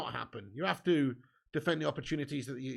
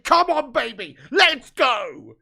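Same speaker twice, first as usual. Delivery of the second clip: much louder and higher celebratory yell; excited cheer; 5 semitones higher, 13 dB louder, much slower than usual. Real, not acted. Fan cheering Arsenal's dropped points at full time.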